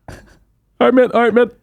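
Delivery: high, sing-song all right mate; mock cheery greeting. Mock greeting of strangers he waved at.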